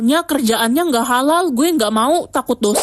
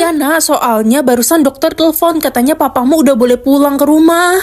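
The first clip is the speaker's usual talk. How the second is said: loud, delighted announcement; happy mother; 2 semitones higher, 6 dB louder than usual. Acted. Mother telling her child Papa can come home, happily. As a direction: share good news at full brightness. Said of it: "tumben hari ini Mama seneng".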